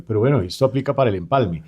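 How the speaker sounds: usual speaking voice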